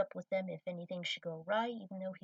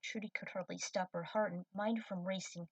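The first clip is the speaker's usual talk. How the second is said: usual speaking voice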